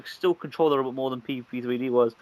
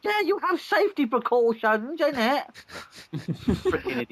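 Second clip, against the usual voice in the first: mock outraged fan; 11 semitones higher than usual. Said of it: "mocking angry fans".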